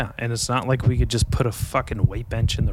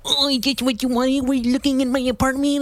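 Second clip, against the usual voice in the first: much higher sing-song jeer; mock taunt; 13 semitones higher than usual. Taunting the neighbor she pictures below, high jeer. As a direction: sneer up at them in a shrill mock voice. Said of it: "and go".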